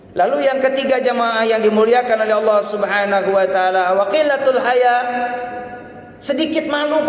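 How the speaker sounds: high, emphatic sermon voice; preaching at full force. Acted. Preacher rebuking shameless Muslims to the congregation, high and emphatic. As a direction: scold the congregation with rising fervour.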